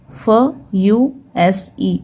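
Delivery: very slow, each letter apart: फ, यू, S, E; spelling out letters. Shown as a demonstration. Teacher spelling out a word for pupils, very slow. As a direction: drag out the letters of fuse one by one. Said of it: "fuse.".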